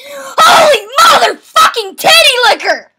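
high, loud disbelieving yell; shocked shout. Real, not acted. Gamer shouting in shock at the game. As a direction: blurt it out in shock.

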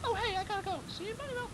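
very high put-on falsetto; high character voice. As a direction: toss it off in a tiny high voice.

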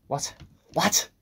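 high, loud yelp of disbelief; confused. Real, not acted. Player lost in the game, high baffled yelp. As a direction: blurt it out sharply upward. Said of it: "I'm confused".

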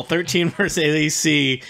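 ordinary delivery

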